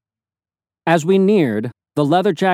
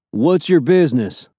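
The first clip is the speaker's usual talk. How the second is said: put-on mild Southern accent. A narrator's reading. Leather-jacketed man questions travelers, mild Southern accent. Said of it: "in a mild Southern accent".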